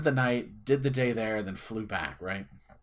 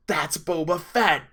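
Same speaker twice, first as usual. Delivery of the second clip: slow, punched-out exclamation; dramatic reveal; 4 semitones higher, 5 dB louder, slower than usual. Retold or imitated. Dad revealing the actor to his son, awestruck emphasis. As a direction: land the name big and drawn out.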